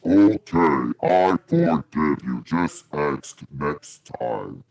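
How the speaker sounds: a big forgiving giant rumbling down; low pitch-shifted giant, slow and kind; giant through voice box. Acted. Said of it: "and he says".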